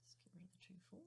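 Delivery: whispered aside. Real, not acted. Aside while editing.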